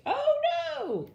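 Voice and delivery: high frightened shriek, loud. A narrator's reading. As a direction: shriek it in panic. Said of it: "they shrieked".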